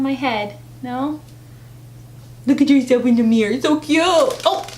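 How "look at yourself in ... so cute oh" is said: put-on baby voice. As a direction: gush at the bird in baby talk. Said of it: "do the baby voice".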